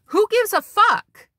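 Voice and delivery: high, loud, drawn-out outburst; exasperated dismissal. Real, not acted. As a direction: throw it out loudly, stretching the words. Mock exasperation at imagined critics.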